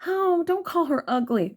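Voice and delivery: high, prissy mock voice; mock viewer. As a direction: whine it like a scandalized viewer. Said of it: "some of you are like".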